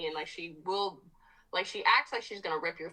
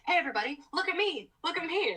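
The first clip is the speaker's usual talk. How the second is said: much higher, bouncy attention-seeking dog voice; eager friendly dog; 11 semitones higher, faster than usual. Retold or imitated. Friendly dog begging for attention from everybody, eager and high. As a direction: play a happy dog begging for notice. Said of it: "gives everybody kisses and is like".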